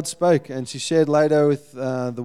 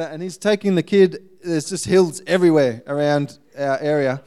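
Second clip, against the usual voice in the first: ordinary delivery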